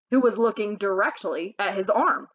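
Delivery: usual speaking voice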